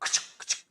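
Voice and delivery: soft voiceless gun clicks; mimicking rifle fire for the children; imitated gunshots. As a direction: whisper the sound of shots. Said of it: "मतलब गोली चल रही है".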